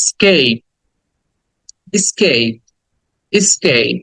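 "স্কেপ" as "i-skeip", falling; repeat-after-me model. Shown as a demonstration. Teacher has students repeat, "স্কেপ" with falling "ei". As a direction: say "স্কেপ" with a long "ei". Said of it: "শব্দটা বলো আমার সাথে".

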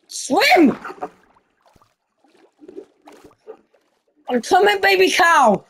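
high, urgent calling out. Real, not acted. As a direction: shout reassurance as you rush in. Rushing to rescue the baby cow.

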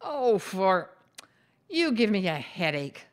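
mild exasperated groan. A narrator's reading. Martha answers Gladys's complaints about coming along, groaning. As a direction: groan it lightly, fed up with her. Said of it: "Martha groaned".

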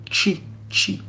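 "chi" said as chee, separately each time; Italian ch sound. Shown as a demonstration. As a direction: model "chi" with a ch at the start. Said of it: "On va lire".

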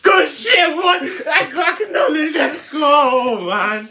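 high, loud drawn-out outburst; worked-up shouting. Real, not acted. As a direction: shout it way up high, stretching the words. Worked up over his joke.

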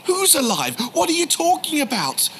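high, incredulous questions; can't believe what he hears; bewildered disbelief. Retold or imitated. Can't believe Jesus alive.

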